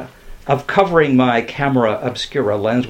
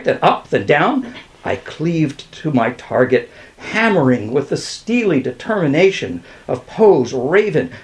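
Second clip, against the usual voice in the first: usual speaking voice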